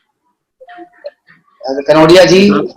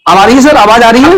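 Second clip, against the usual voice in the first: raised, louder voice checking the line; calling out; 4 semitones higher, 5 dB louder, much faster than usual. Real, not acted. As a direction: call out to test the connection, pitch lifted. Checking whether the audio gets through.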